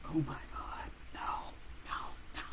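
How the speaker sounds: breathy whispered oh no; frightened girl whispering as the blood spurts; whispered panic. Retold or imitated. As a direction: whisper it in dread. Retold terrified inner thought.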